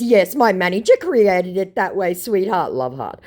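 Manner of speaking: high, loud mock scammer, syrupy pet names. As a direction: ham up the fake sweetness. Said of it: "To which my idiot says".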